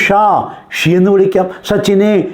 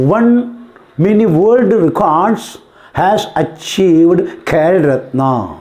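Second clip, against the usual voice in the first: slow, word by word example sentence; slow dictation; much slower than usual. Shown as a demonstration. Teacher dictating an English example sentence to students, slowly. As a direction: spell out each word slowly for the class.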